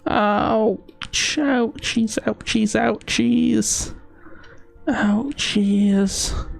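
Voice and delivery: high, whiny repeated ouchies; whimpering ouchies. Real, not acted. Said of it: "I just hurt my brain".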